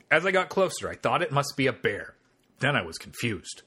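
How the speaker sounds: ordinary delivery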